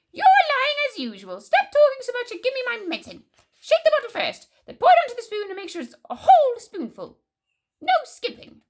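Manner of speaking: put-on high, loud bossy old grandmother; cranky Grandma, bossy. A narrator's reading. Grandma orders George to pour her medicine, high and cross.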